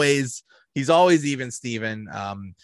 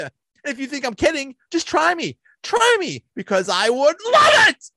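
much higher and louder, a fast hammed-up tirade; furious shout; 6 dB louder, faster than usual. [name] turns on the seat-kickers at the movies: hammed-up furious shout. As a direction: blast the rowdy kids with an over-the-top threat. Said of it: "I did that horribly".

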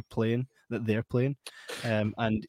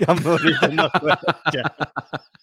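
very slow, drawn out through laughter; laughing; 4 semitones higher, 5 dB louder, much slower than usual. Real, not acted. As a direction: repeat it, cracking up as you stretch it. Refuses to read awkward name.

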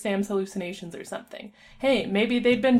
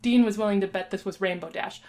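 plain reading voice

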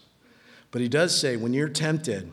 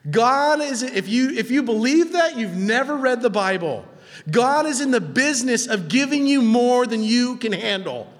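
raised, driving preacher's voice; impassioned preaching; 10 semitones higher, 6 dB louder than usual. Real, not acted. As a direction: preach it higher, with building energy.

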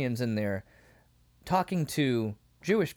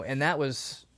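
normal talking voice

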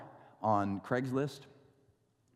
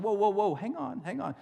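higher, startled whoa, hang on; alarmed whoa; 8 semitones higher than usual. Retold or imitated. Pastor halts the buyer marking his car title, startled. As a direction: stop him before he marks the title.

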